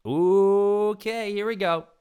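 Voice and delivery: very high, loud hype-up; hyped. Real, not acted. Psyching himself up for the question, loud and high. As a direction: launch into it like a game show host.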